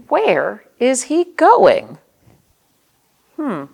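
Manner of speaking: gentle picture-book narration with playful questions; reading to children. Acted. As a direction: read it like story time for little kids.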